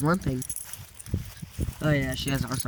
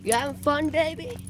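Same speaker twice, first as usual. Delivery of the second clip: sweet pet talk; 11 semitones higher than usual. Real, not acted. Sweet talk to old dog.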